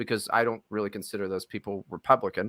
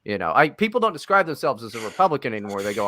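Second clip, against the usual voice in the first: ordinary talk, nothing marked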